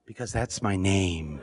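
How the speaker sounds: low, slow, dignified voice; Obama's calm reply. Retold or imitated. Obama answering why his name is there, low slow calm dignity. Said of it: "And he said".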